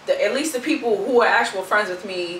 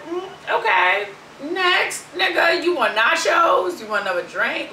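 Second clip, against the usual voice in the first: awkward deflection; 5 semitones higher than usual. Retold or imitated.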